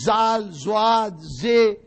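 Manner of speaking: زال، زواد، زے spelled out slowly and loudly, each letter apart; letter pronunciation demo. Shown as a demonstration. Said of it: "زواد کا صحیح تلفظ ادا کرتے ہیں".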